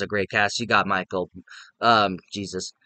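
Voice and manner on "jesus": ordinary talk, nothing marked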